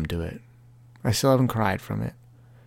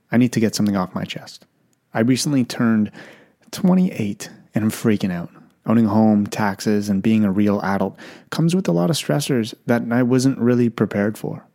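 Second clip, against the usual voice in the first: louder, punchy podcast ad voice; upbeat sponsor read, clearly louder; 6 dB louder than usual. Acted. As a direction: sell it like a host-read radio spot.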